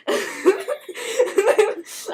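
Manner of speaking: a high, loud burst of real laughter; real laughing fit. Real, not acted. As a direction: crack up helplessly. Speaker cracks up.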